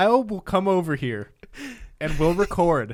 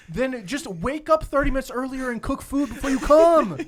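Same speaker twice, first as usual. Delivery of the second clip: exasperated lecture; 9 semitones higher than usual. Retold or imitated. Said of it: "I'm like".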